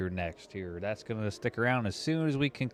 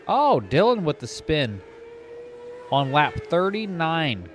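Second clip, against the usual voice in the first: excited; 6 semitones higher, 3 dB louder than usual. Real, not acted. Race caller sees a spin.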